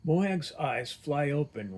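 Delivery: ordinary delivery, nothing marked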